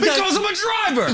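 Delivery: high-pitched indignant imitation; hammed-up outburst of a cornered driver; mock defensive shout. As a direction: yell it high, mock-protesting. Acting out defensive driver's answer.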